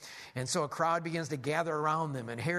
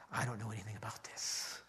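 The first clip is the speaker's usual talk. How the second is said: whispered, much softer, feigning innocence; secretive whisper; 6 semitones lower, 8 dB quieter than usual. Acted. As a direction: hush it like a sly king hiding something. Herod feigns ignorance to magi.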